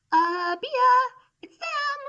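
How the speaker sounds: very high, panicky bee voice; scared little worker. Acted. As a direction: squeak out in alarm. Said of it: "a shy worker called out".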